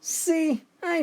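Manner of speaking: sudden high gloating cry; I told you so, shouted high; triumphant shout. A narrator's reading. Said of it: "the bartender shouts".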